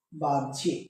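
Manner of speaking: বাহ্যিক low and soft, "baj-jhik"; demonstrated pronunciation. Shown as a demonstration. Teacher repeating for students, বাহ্যিক as baj-jhik. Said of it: "তাহলে এটার উচ্চারণ কি হবে".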